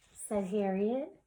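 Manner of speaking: ordinary delivery, nothing marked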